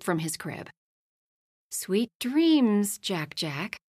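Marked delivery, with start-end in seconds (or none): none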